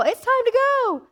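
mock the restless flesh itself; high eager flesh voice. Preacher voicing the impatient flesh, high and eager. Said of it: "Your flesh may go".

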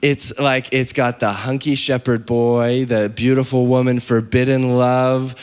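usual speaking voice

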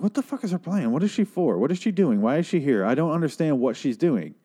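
exasperated bafflement. Retold or imitated. Viewer questioning why the scientist lady is there, exasperated. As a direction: pepper the questions out in baffled frustration. Baffled rant about a character.

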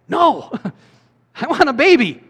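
very high, laughing woman's reply; mock Hannah. Preacher voicing Hannah answering her husband, high and laughing. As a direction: blurt it like an exasperated wife.

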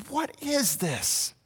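slow, high, soft wondering question; hushed, drawn-out astonishment; amazed. Retold or imitated. The people reacting to the exorcism, slow and high in awe. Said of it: "the response from the people is like".

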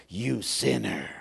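low, drawn-out accusing voice; mock condemnation. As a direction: condemn like a stern judge, low and slow.

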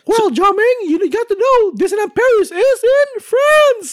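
slow, pointed cartoon falsetto; Nikki Moss. Cartoon correspondent setting Jiaming straight, slow high falsetto. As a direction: lecture in a very high squeaky voice. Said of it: "this is Nikki Moss".

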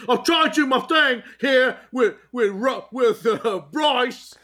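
high hammed-up English complaint; mock Bale rant. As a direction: vent as an exasperated method actor. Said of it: "He's trying to do his thing".

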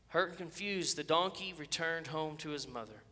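usual speaking voice

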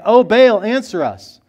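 loud, high pleading call; desperate cry to Baal. A narrator's reading. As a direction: cry out to a god who won't answer. Prophets of Baal crying to Baal.

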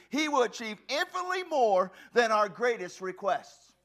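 loud, high, slow, drawn out; preaching at full force. Real, not acted. Preacher thundering scripture to the congregation. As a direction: preach it with all you have.

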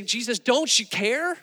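mock alarm, rising into an urgent question; panicked disciples. Freaking-out disciples waking Jesus, panicked. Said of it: "they're freaking out".

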